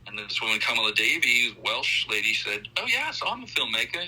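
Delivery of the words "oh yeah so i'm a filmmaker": high-pitched, badly faked British accent; hammed-up British accent, pitched up; horrible British accent. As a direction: imitate a Welsh lady with a poor British accent. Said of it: "I do a horrible British accent".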